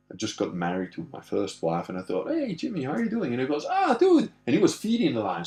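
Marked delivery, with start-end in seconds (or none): 2.3-3.3 high-pitched acted hello; cheery greeting. Visitor greets lion farmer after ten years, acted cheery greeting. Re-enacts calling out to old friend. 3.7-4.3 high, loud acted exclamation; delighted greeting. Lion farmer spots his old friend, acted delight.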